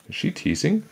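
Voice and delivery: high-pitched questioning; a puzzled, lifted question; puzzled wondering. A narrator's reading. Jack's puzzled thought, voiced high.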